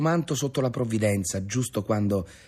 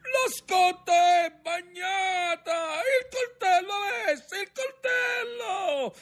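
very high, drawn-out cries; desperate yelling; slower than usual. A narrator's reading. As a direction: yell for help over the gale. Sailor yelling for knife in storm. (urla disperate, acutissime)

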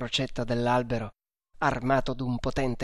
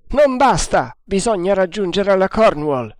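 high, loud insistent command; shouted order; 6 semitones higher, 7 dB louder than usual. A narrator's reading. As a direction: drive them harder.

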